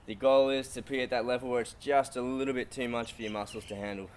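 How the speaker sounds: ordinary delivery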